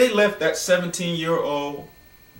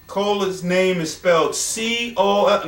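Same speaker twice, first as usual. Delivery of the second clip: usual speaking voice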